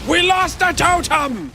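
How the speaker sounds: high slow dismayed yell; alarmed shout. Real, not acted. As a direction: cry out the bad news. Losing the totem.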